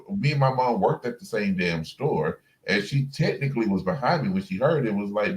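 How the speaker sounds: ordinary delivery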